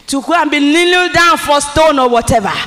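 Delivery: loud, high preaching shout of refusal; fervent shout. Acted. Preacher voices the believer's loud prayer of refusal. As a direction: thunder it out, refusing to settle.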